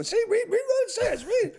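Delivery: high, loud, pushy; excited high insisting; eager insisting. Retold or imitated. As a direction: shove the bag at him, read it. Pushing cop to read bag.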